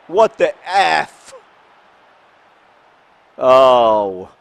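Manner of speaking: loud, high slow burst of exasperation; angry outburst. Real, not acted. Gamer reacting to a goal against his side, angry outburst. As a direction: cry out in anger at the game. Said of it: "Now I'm angry".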